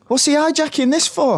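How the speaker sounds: very high-pitched indignant protest; mock outrage. Real, not acted. Presenter complaining the others hijacked the segment, indignant. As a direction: squawk in comic exasperation.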